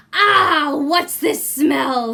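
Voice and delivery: a big giant sniffing the air; booming giant. Acted.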